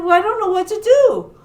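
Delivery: high, quick, helpless worry; worried fretting. Acted. Shark unsure how to save friends, fretting.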